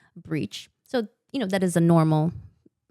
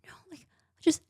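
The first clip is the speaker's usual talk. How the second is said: urgent whisper; 10 semitones higher than usual. Retold or imitated. Mother begging staff to wait.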